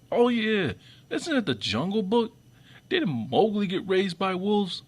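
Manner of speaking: high, sarcastic oh-yeah discovery; mock realization. To viewers, sarcastically pretending to remember the Jungle Book. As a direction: play dumb like it just dawned on you.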